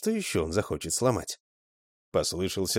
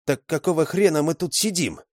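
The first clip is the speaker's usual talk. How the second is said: higher, indignant outburst; 8 semitones higher, 4 dB louder than usual. A narrator's reading. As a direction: demand it in outrage. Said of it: "возмутился Игорь".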